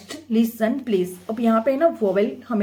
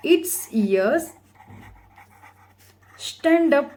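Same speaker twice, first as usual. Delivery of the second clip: slow dictation; 4 semitones higher, much slower than usual. Shown as a demonstration. Dictating the answer.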